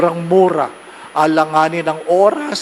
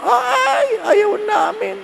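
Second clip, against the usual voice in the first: high, squealing refusal, much higher; mock shrill refusal; much slower than usual. To the visiting priest, a hammed-up high refusal. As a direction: squeal it like scared parishioners refusing.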